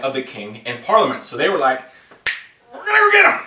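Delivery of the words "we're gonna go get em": high-pitched comic rallying cry; mock battle cry. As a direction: squeal it like a silly soldier charging off. Voicing eager British going after them.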